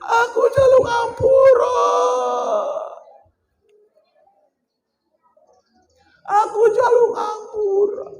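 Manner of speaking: begging wife. Acted. Wife begs forgiveness.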